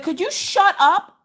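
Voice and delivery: put-on high, slow, fed-up little girl. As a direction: drag it out like a shy kid finally snapping. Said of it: "She's like".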